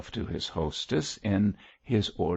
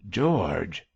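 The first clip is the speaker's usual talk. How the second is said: ordinary delivery, nothing marked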